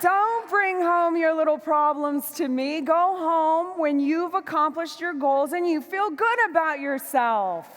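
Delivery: stern scolding. Retold or imitated. Wife sending husband back to work, stern scolding. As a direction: order him back out the door.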